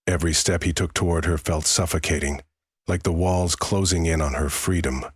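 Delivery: ordinary delivery, nothing marked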